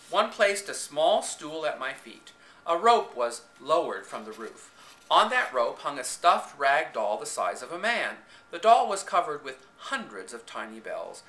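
ordinary delivery, nothing marked

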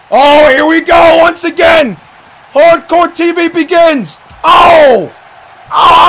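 high, loud, whooping excitement; thrilled wrestling announcer; shouted play-by-play. Real, not acted. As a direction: yell every blow as it lands. Live play-by-play, big moments.

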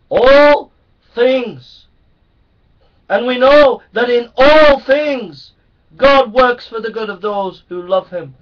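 loud, high, impassioned sermon; fiery preaching. Acted. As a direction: preach it to the back row. Preaching at full force.

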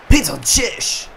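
slow, drawn-out, booming call of "Peter Cech"; announcer voice. Shown as a demonstration. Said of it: "so cool klingt das bestimmt nicht".

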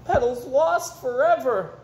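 put-on a child sure her doll is gone for good; distraught child. The little girl cries out that her doll is lost, slow and high.

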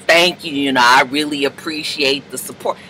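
low, soft, snide thank you with "Thank" leaned on; salty thanks. Retold or imitated. Mocking the cheater's salty thank you to his ex. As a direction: give the thanks a little salt. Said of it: "put a little salt in it".

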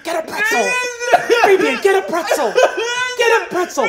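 high, pushy nagging voice; high-pitched scolding mom. Acted. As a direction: nag him like an exasperated mom. Sandra Lynn scolding, high.

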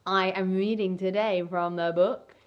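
ordinary delivery

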